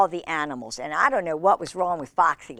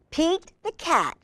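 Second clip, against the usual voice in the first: ordinary delivery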